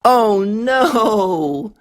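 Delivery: usual speaking voice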